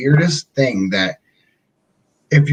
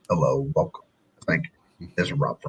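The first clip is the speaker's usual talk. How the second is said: much lower, softer customer service greeting; phone voice; 11 semitones lower, 6 dB quieter, faster than usual. Retold or imitated. Retelling answering a work call, low smooth phone voice. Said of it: "That's my phone voice".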